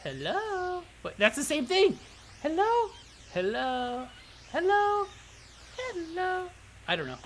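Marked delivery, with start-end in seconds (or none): 0.0-0.8 lilting falsetto attempt; different female voice. Shown as a demonstration. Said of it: "do a different female voice?". 2.4-6.5 testing high feminine greetings one by one; put-on falsetto, stretched out; female voice attempts. Shown as a demonstration. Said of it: "do a different female voice?".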